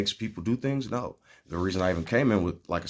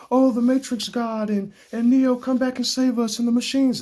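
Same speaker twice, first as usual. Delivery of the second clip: high, loud pleading parody; a much higher, louder, mock-desperate plea; mocking prayer; 6 dB louder than usual. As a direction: beg the Matrix God like a fool. Mocking future people's prayers.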